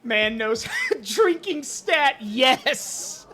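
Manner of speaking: high, slow, breaking into a laugh; real laughing delight. Real, not acted. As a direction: crow it slowly through laughter. Laughing at the drinking stat.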